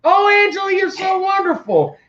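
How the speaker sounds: very high swooning voice; gushing fan. Retold or imitated. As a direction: swoon over her in falsetto. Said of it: "he was more like".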